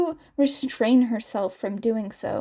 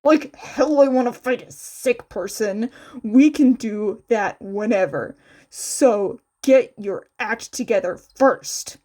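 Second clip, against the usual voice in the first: louder, gruff scolding; gruff refusal; 5 dB louder than usual. Acted. Kacchan refusing to fight a sick friend, louder. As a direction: grumble it at them.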